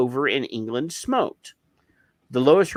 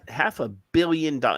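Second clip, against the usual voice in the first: ordinary delivery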